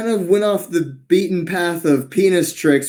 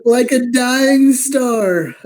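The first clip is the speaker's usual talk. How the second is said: high, drawn-out gleeful echo; amused mock echo; 9 semitones higher, 4 dB louder, much slower than usual. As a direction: repeat it slowly, pitched up, relishing the phrase. Gleefully echoes the guest's phrase.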